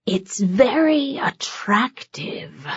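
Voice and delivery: leer and linger on attractive; sly demon purr. A narrator's reading. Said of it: "The way he emphasized that last word".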